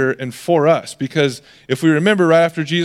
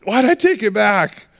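higher-pitched exasperated outburst; mock losing temper; 6 semitones higher than usual. Parent blowing it with the kids after school, mock exasperated. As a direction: act out blowing it in frustration. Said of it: "you blow it".

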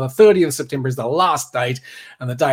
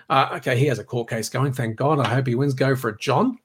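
usual speaking voice

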